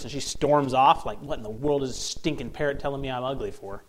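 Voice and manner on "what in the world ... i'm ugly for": usual speaking voice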